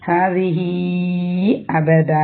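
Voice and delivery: ഹാദിഹി അബദ recited very slowly, the hi stretched four to five counts; long madd. Shown as a demonstration. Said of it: "four or count five count നീട്ടാം".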